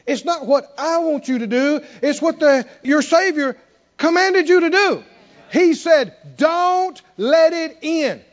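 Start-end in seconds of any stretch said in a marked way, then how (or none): 2.0-8.2 louder, higher commanding proclamation; the last words slow and drawn out; preaching at full force. Acted. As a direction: thunder the command from the pulpit. Preacher declaring Jesus' command.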